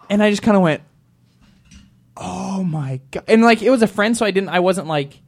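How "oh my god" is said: stunned slow gasp. Retold or imitated. Stunned reaction.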